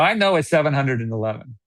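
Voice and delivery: normal talking voice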